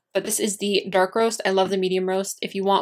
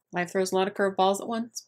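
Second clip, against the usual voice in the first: normal talking voice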